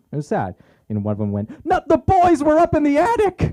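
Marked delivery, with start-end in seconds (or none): 1.7-3.5 high, loud hammed-up wail; over-the-top shrieking lament; crying priest. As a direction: wail in mock anguish at full volume. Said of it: "group of priests crying outside".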